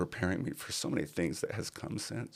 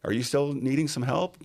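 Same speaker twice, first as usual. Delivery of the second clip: cheerful caller; 8 semitones higher, 6 dB louder than usual. Retold or imitated. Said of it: "the phone call".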